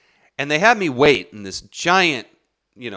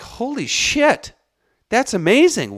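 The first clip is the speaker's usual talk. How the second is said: high-pitched awestruck exclaiming; retold amazement; 11 semitones higher, 4 dB louder than usual. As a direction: relive being blown away by it.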